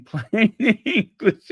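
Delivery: laughing through the words; breaking into laughter. Real, not acted. Host reading a viewer's joke aloud, breaking into laughter. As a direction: crack up midway through reading.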